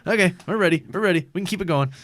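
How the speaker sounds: loud, high-pitched manic rush; hammed-up wired surgeon psyching himself up; hyped-up doctor. Coked-up doctor telling staff he's ready, manic.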